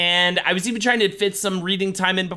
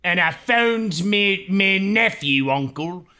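fake British accent; 3 semitones higher, much slower than usual. Co-host riffs on Titanic, slow mock British accent. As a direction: put on a shaky cod British accent. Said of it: "I can't think of any other British slang words".

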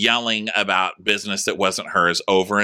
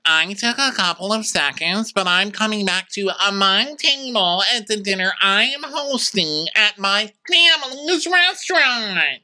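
much higher, slowing, put-on grand voice; haughty Mia; 12 semitones higher than usual. Mia returning to her own dinner table, high slow mock grandeur.

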